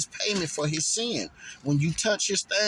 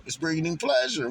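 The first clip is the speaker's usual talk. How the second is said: normal talking voice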